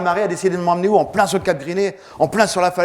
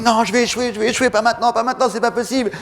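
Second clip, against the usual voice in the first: higher, urgent determination; desperate inner refusal; 7 semitones higher than usual. Retold or imitated. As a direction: refuse to fail, pushing hard. Refus intérieur désespéré. (refus désespéré, voix tendue et plus aiguë)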